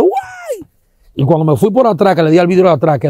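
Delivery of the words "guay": very high falsetto cry of panic; imitating passengers screaming as the bus is smashed; mimicked scream. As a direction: shriek it like a frightened crowd. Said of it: "la gente estaba gritando".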